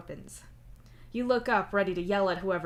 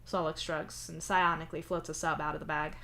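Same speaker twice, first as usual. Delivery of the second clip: usual speaking voice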